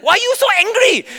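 mom's high, loud yell; shrieked accusation in a family argument; mock yell. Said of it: "We would yell, we would scream".

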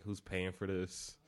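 ordinary delivery

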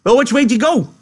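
high, loud, angry question; aggressive demand. A narrator's reading. Curly sizing George up, then demanding loudly and angrily. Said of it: "he demanded at last".

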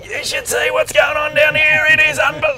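high, loud stoked shout down the phone; excited shout. Retold or imitated. Editor calling from the surf, excited shout. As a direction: yell it between waves, buzzing.

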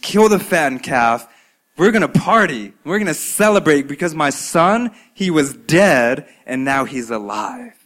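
loud, eager orders for a feast; joyful father. Acted. Father ordering servants for his son's return, excited and loud. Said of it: "he says".

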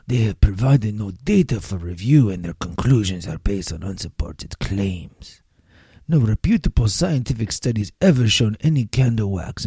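mock the candle lobby in a bad French accent. Host reading the lobby's statement in a bad French accent. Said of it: "my really bad French accent".

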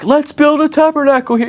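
high-pitched overexcited squeal of an idea; hammed-up starstruck outburst; freaking out. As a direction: blurt it out high, giddy and overwhelmed. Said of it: "he's, like, freaking out".

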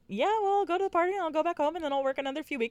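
high, fast, carefree mock voice; mock breezy plan. Mocking Andy planning her career, high and fast. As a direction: rattle it off like a flippant airhead.

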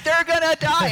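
high, loud frantic cry; hammed-up terror at the screen; loud, high mock panic. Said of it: "You're like".

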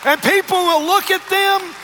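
high, loud, shouted sermon delivery; fiery preacher lifting the congregation; full-force preaching. Acted. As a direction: preach it out to the whole room.

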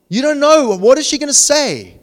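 high, loud, fretful outburst; anxious suitor. Acted. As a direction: play up the panic of not knowing her answer. Acts out nervous suitor awaiting answer.

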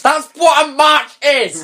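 high, incredulous exclamation; exasperated outburst. Real, not acted. Mocking the book's silly line, incredulous. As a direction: throw it out in disbelief.

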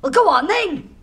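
high, loud burst of excitement; eager and pushy; excited. Acted. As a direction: partner excited and hurrying him on. Impressed then pushing.

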